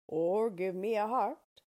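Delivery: a hint of a sturdy tin man pleading; Tin Woodman voice. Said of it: "said the Tin Woodman".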